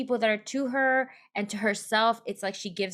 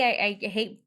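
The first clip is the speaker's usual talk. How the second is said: normal talking voice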